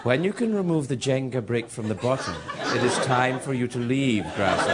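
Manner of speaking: mock-wise master addressing a pupil; Kung Fu master. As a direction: intone it like an old TV sensei. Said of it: "title sequence to the old Kung Fu T V series".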